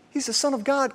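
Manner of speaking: like blurting out what you know; high, eager aside to the crowd; eager insider. Retold or imitated. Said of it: "And all along we're like".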